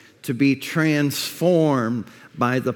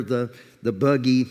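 ordinary delivery

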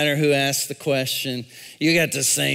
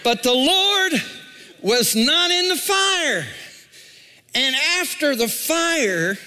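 much higher and louder, laughing into it; soaring preaching; 11 semitones higher, 3 dB louder than usual. Acted. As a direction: lift the line to the rafters with joy.

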